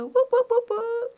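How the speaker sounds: high, loud whooping cheer; hype whoops. Real, not acted. Host welcoming new listeners to the show, high playful whoops.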